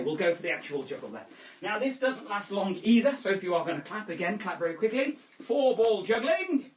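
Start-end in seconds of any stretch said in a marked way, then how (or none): 5.4-6.7 slow, drawn-out trick announcement; showman announcer. Acted. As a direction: present it like a circus act.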